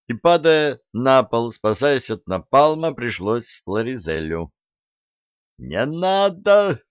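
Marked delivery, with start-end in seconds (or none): 5.6-6.8 high, slow yell. A narrator's reading. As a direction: scream it to stop him. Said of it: "заорал батлер". (крик дворецкого)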